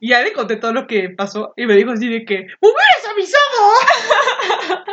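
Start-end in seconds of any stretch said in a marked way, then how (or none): 2.4-4.9 high, slow, altered playful protest; mock complaint. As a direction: scold jokingly. Ana reclamando en broma. (reclamo en broma, agudo y lento)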